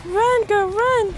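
urgent high cry to run; being chased. Real, not acted. Urging character while being chased.

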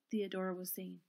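plain reading voice

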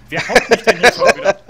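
loud high outraged yell; indignant shout. Real, not acted. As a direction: complain loudly. Wird im Spiel geschlagen. (laut empört rufen)

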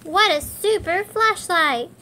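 praising the flashlight, delighted. Acted. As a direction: marvel at it. Admiring the flashlight.

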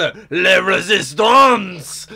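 lightly put-on French accent; mock French accent. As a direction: savour Resistance like a mock Frenchman. Mocking the French in a game.

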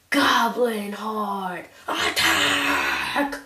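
rising slow war cry; battle cry. Acted. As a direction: bellow the order to charge.

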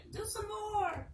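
high, soft mock viewer voice; mock viewer request. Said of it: "and then you be like".

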